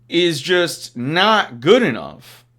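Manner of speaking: normal talking voice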